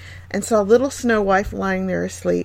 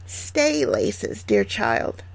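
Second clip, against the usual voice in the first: softer, half-whispered crone voice; old peddler woman; 4 semitones higher, 5 dB quieter, much slower than usual. A narrator's reading. Disguised queen offers laces, soft whispery crone voice. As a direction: coax her in a hushed hag voice. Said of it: "the old woman replied".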